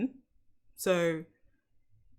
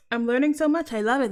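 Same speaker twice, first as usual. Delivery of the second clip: excited; 5 semitones higher, 6 dB louder, faster than usual. Real, not acted. Excited after accent lesson.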